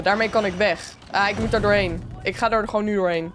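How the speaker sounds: ordinary talk, nothing marked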